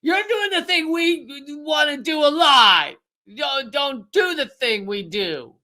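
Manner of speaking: high shouted hypocritical scold, slowing at the end; mocking America. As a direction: point the finger and yell like a hypocrite nation. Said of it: "point to other countries and go".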